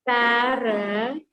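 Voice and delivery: "Paragon" very slow, drawn out syllable by syllable; split into syllables. Shown as a demonstration. Teacher showing three syllables to class, "Paragon" slowly broken up. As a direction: stretch "Paragon" into three separate parts. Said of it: "Three syllables".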